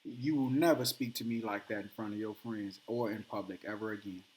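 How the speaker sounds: low, soft, icy calm warning; calmest voice. Retold or imitated. Husband calmly warning his wife after she shamed him, cold quiet calm. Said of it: "In his calmest voice".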